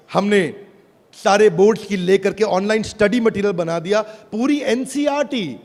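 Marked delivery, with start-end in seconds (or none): none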